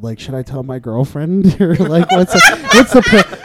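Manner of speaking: can barely get the words out laughing. Retold or imitated. Laughing through retold call.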